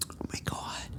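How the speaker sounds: barely audible whisper; stunned whisper; hushed shock. Acted. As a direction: whisper it, suddenly alert. Startled by a noise outside.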